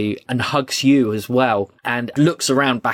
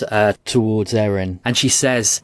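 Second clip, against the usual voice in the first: ordinary talk, nothing marked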